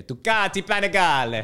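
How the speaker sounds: Ducati Panigale in a light Italian accent, tukati panigale. Shown as a demonstration. Naming his dream bike, Ducati Panigale in Italian accent. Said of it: "You gotta say those Italian names with the".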